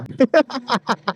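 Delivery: short burst of laughter; real laugh. Real, not acted. Vlogger amused by his own boast, real laugh.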